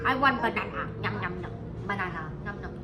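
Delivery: low, put-on monkey voice; hungry monkey. Teacher playing monkey wanting banana, low munching voice.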